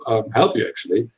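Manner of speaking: ordinary talk, nothing marked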